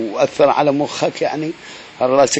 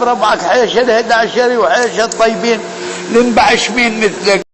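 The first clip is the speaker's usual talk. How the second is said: furious rant; 9 semitones higher than usual. Acted. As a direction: shout the insults like you mean it. Ranting insults at Abu Khaled.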